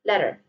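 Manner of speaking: "Letter" as "ledder", tongue tapped; American "Letter" with r at the end; American flap. Shown as a demonstration. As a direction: flick the middle t of "Letter". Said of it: "Pay attention to the R sound".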